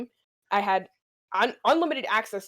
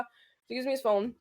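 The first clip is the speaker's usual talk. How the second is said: ordinary talk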